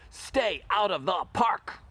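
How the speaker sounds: loud, slow, punched-out order; stern shouted warning. Acted. Dog catchers at the park's edge to dogs, stern shout. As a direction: shout it word by word.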